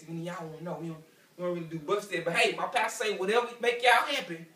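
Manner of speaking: ordinary delivery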